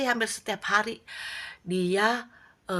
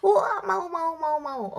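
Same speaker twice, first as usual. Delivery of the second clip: much higher, louder eager yelp that settles; eager kids; 11 semitones higher, 5 dB louder, slower than usual. Acted. Boys grabbing the old man's offer, excited. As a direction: jump at the offer like a kid.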